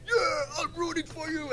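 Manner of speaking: teasing imitation of a towering fighter, voice pushed high; imitating the big fighter. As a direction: mimic a giant cheering you on in a high put-on voice. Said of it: "He's like".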